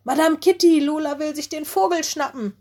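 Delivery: high, loud urgent call out; alarmed call. A narrator's reading. Little wolf calling the headmistress in alarm. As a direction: cry out to warn the teacher. Mino calls out alarm to teacher.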